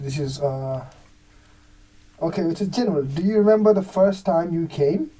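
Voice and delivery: ordinary talk, nothing marked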